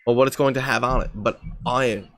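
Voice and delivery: put-on English accent, insisting innocently; casual mock-English explanation. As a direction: bluff along in a fake English accent. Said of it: "don't say previously".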